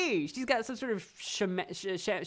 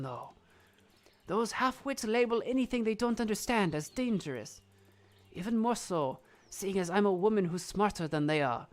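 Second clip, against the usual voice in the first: disdainful wise woman, put-on accent; wry witch Madame Guide; 4 dB quieter than usual. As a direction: voice an accented witch dismissing gossip.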